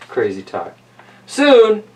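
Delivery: ordinary delivery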